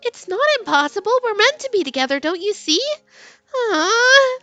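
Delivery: put-on high, pleading teen girl; high girly voice. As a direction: plead that they belong together. Heroine voiced in read story.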